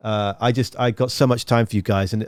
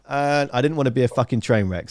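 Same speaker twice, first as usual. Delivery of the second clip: normal talking voice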